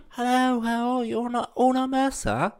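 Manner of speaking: high English voice stumbling through "Una, una mesa"; an English tourist ordering in Spanish; English accent in Spanish. Pretend. Englishman in a podcast sketch orders Spanish in an English accent. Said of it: "I'm an Englishman trying to talk in Spanish".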